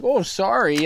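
high-pitched sheepish sorry; acted apology. Dog owner apologising for the maimed chicken, high-pitched apology. Acting out his embarrassed apology.

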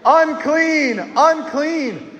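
high shouted demo of unclean; leader's call to repeat; called out for the kids. Shown as a demonstration. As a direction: show the kids how to cry it out. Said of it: "Come on, kids".

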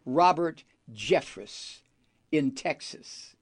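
ordinary delivery

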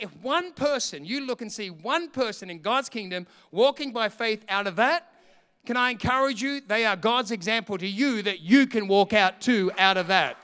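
rising preacher's appeal, building in force; preaching. Acted. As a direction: press the hope on the congregation.

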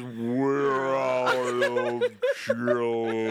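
slowed, low, dragged-out words; a drunk hearing the news in slow motion, dragged out; slow-motion echo. Retold or imitated. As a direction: stretch it like the room is spinning. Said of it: "all I heard was".

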